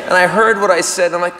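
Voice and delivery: ordinary talk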